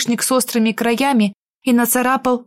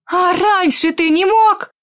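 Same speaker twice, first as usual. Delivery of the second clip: higher, slow, indignant; annoyed; 7 semitones higher, slower than usual. Acted. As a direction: drag out the reproach. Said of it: "разозлилась я". (раздражённо)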